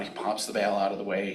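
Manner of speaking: normal talking voice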